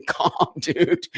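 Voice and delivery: chuckling, telling herself to calm down; giggling self-reminder to calm down; laughing at self. Real, not acted. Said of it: "I get very heated".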